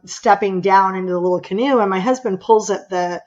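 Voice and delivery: ordinary talk, nothing marked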